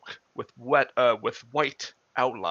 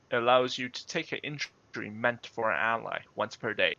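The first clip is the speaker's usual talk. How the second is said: ordinary talk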